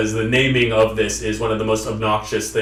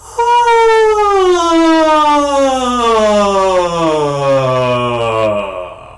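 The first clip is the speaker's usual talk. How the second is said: loud sigh starting very high and gliding all the way down; sigh through full range; 9 semitones higher, 6 dB louder than usual. Shown as a demonstration. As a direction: slide from the top of your range to the bottom on one sigh. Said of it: "go from the very top of your range all the way to the bottom".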